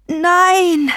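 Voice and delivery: high, loud, falling cry; roared no. A narrator's reading. Possessed grandfather fighting back upstairs, roaring. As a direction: roar it out in protest. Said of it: "Grams brüllte".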